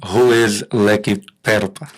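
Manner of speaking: normal talking voice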